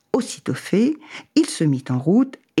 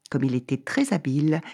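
ordinary delivery, nothing marked

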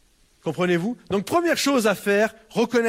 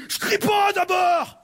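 high, slow, hammed-up yell; mock indignant shout; 10 semitones higher, slower than usual. Angry person snapping back at the pastor, high mock shout. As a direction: shout the denial like a sulking child.

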